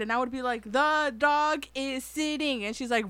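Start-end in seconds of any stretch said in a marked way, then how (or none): 0.7-2.6 slow, higher, separated reading; laboured beginner read-aloud with gaps between words. Retold or imitated. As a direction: imitate a first grader reading each word carefully. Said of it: "Go word by word".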